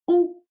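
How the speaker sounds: "Où" said as a pure high oo; French word où. Shown as a demonstration. Teacher models "Où" before pupils repeat. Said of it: "Repeat after me".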